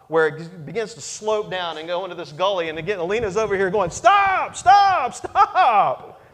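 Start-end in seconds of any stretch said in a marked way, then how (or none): 3.9-6.2 much higher, louder panicked calls; wife yelling stop. Retold or imitated. Wife shouts at husband to stop driving, high panicked yell. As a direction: cry out to make him stop.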